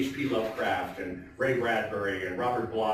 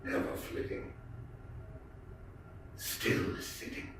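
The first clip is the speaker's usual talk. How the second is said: fading to a whisper; 6 semitones lower, 8 dB quieter than usual. Acted. Host ending The Raven for the audience, fading whisper. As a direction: lower and softer, ending voiceless.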